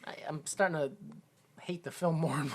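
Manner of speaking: half-whispered, breathy aside. Real, not acted. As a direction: mutter it under your breath. Mutters complaint about the film.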